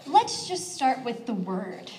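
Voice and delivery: ordinary talk, nothing marked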